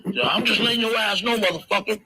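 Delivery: high-pitched imitation of an angry cop; Reggie Senior. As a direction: ham up a cop barking a threat. Retold threat from Reggie Senior.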